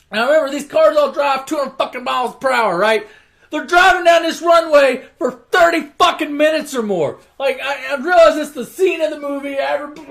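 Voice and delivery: really getting worked up about the endless runway; worked-up rant. Real, not acted. Ranting at the absurd runway.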